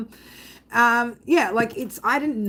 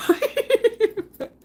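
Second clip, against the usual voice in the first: high laughing right; real laugh, high and bright; 3 dB louder than usual. Real, not acted. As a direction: laugh it off at yourself. Laughs at her own rambling.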